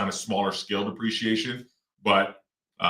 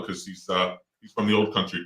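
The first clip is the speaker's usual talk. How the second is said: ordinary delivery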